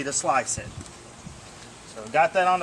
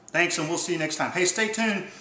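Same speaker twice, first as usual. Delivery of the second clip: usual speaking voice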